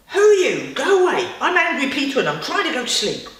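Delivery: put-on loud, high, furious child yelling go away; angry shout. Boy yelling at the superpower to leave him alone, angry shout. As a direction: shout it like a cross little boy.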